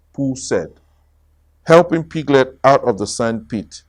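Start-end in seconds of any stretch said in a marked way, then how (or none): none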